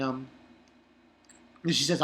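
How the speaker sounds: ordinary delivery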